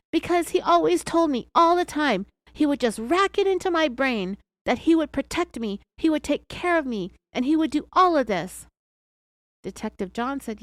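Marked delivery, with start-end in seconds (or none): 0.1-8.7 higher, louder imitation of an upset woman; retold upset protest. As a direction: act out her distressed insistence, pitched up. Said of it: "as she sobbed".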